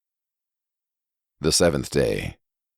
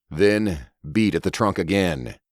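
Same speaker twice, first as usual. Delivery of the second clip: plain reading voice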